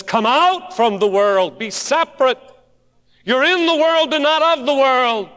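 high, loud preached quote; preaching climax. Acted. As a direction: lift it like a pulpit cry. Quoting Jesus, preaching.

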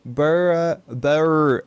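normal talking voice

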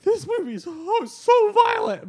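high, loud mock gasp of shock. Remembering a first viewing, mock shocked and high. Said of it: "I remember, like".

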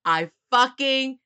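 furious shout. Acted. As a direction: yell it like you caught the traitor. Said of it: "And I was like".

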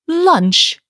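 high, loud fretful echo of the word; a fussy father repeating it in disbelief; querulous echo. A narrator's reading. Father echoes his daughter's question, querulous and high. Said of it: "he echoed querulously".

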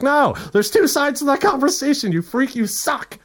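high-pitched indignant outburst; livid rant. Acted. Ranting at someone who never responded, livid. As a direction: go full bore, livid and high. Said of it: "am I sounding a bit livid".

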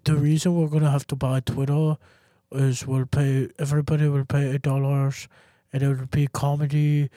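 put-on halting, stilted accented delivery; Elon Musk. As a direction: impersonate an awkward tech boss. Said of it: "I think Elon sounds like that".

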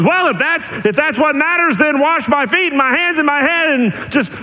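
high, eager rush of impulsive offer; impulsive, suddenly all in; eager flip. Retold or imitated. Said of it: "flips very quickly and goes".

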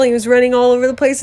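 ordinary delivery, nothing marked

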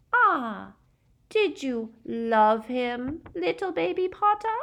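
high, slow taunting coo; drawn-out babyish mockery. A narrator's reading. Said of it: "her baby voice".

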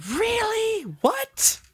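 breathy high gasp then a squealed what; hammed-up astonishment over old news; gasping surprise. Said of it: "show you my level of excitement through a tableau".

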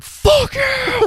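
high, loud, drawn-out mimicked shriek; hammed-up breakdown yell; mock scream. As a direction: imitate a YouTuber's sudden screaming outburst. Mimicking YouTuber's screamed outburst from clip.

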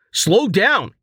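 high, loud pleading yell; yelling back. A narrator's reading. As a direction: shout it, begging her to stop. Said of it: "Rain yelled back".

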